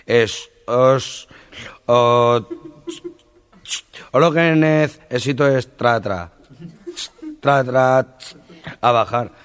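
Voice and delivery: stammering, stuck on the first sounds; stutterer. Retold or imitated. Stutterer asking the sawmill owner for work, stammering. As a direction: fight to get each word out. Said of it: "el tartamudo, encima que era tartamudo".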